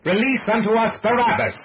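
high, full-force crowd roar; loud, high-pitched demanding shout; bellowing mob. A narrator's reading. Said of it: "Like the bellowing of wild beasts".

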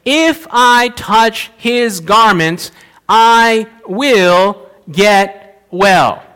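slow, deliberate, word by word; measured, determined self-talk; inner resolve. Acted. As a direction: stretch each word with firm resolve. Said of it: "this was their internal dialogue".

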